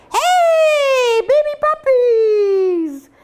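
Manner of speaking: put-on high, loud sing-song call of hey, baby puppies; imitating a playful shout. Husband calling out to the puppies, loud and playful. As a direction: startle the puppies with a big happy yell. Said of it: "he likes to make noises and go".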